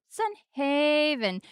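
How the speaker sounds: "Sun Haven" sung out slowly, pitched high on Sun; drawn-out Sun Haven. Real, not acted. Streamer showing viewers a pet affectation, drawn-out Sun Haven. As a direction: stretch "Sun Haven" into a slow, high flourish. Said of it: "why I say it that way".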